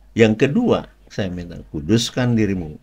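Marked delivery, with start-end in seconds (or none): 1.7-2.8 firm, measured command; firmer. Retold or imitated. Imitating the second divine call, more firm. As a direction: deliver it as a firm call at normal volume. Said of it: "Makin tegas".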